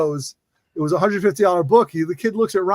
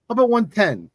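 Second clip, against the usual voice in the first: higher-pitched New York counteroffer; New York accent, pitched up; Jersey New York accent; 5 semitones higher than usual. Retold or imitated. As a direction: imitate a Jersey kid haggling. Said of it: "New York accent".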